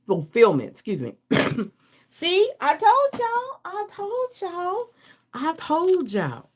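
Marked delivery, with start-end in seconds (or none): 2.1-6.5 high, slow, teasing I told y'all; sing-song told-you-so. Real, not acted. Gloating to her viewers because the cards came true, high sing-song.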